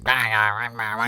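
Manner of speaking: mumbled nonsense imitation; Munchie. Imitating a guy who talked funny, muffled gibberish. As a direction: garble repeated syllables in a funny voice. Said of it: "he talked like that and shit".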